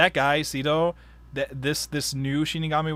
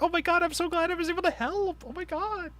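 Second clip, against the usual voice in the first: put-on much higher girly gush; Misa gushing; 4 dB quieter than usual. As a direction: squeal it like an overjoyed Misa. Misa voiced gushing happily.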